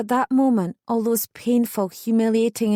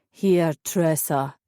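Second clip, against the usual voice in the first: put-on slow, low drunken drawl; low, sluggish drunk voice; drunken slur; 4 semitones lower, much slower than usual. A narrator's reading. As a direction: slur it, plastered and heavy. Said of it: "he slurred".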